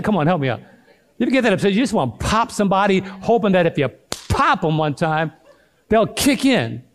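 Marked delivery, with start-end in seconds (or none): none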